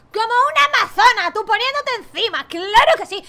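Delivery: loud, high cheer; excited cheering. Acted. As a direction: cheer her on like a hype coach. (animándola a gritos, muy agudo y fuerte)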